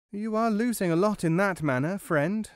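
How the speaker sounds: high little mouse, offering advice; mouse character. A narrator's reading. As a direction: advise him in a high mouse voice. Mouse advises dairyman in the tale.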